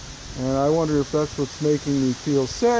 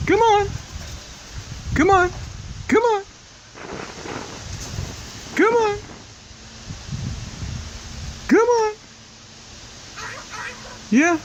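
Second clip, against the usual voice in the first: very high sing-song coaxing; coaxing a wild bird. Real, not acted. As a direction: coax it out in a high voice.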